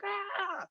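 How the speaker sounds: high, held-back falsetto scream; re-enacted scream of pain, softened for storytelling; mock scream. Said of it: "I'm just screaming out".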